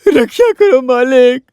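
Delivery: slow, mock-tearful begging; hammed-up wailing plea. Man begs his master to save his life, hammed-up wailing. Wails to master that life's in danger.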